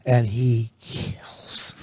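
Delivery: dry whisper. Real, not acted. Deadpan aside about rival.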